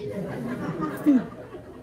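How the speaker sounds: high, airy burst of laughter. Real, not acted. Laughs at his own joke.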